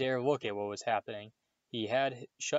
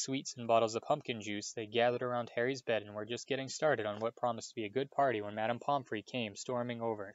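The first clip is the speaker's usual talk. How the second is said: plain reading voice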